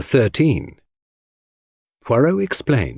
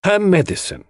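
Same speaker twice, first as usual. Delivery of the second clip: higher-pitched dramatic reveal; impressive; 10 semitones higher than usual. Acted. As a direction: announce the answer for effect. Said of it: "answered himself impressively".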